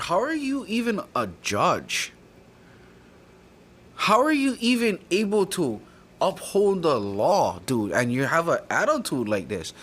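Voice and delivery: high, incredulous courtroom outburst; indignant challenge. Retold or imitated. Retold confrontation with the judge.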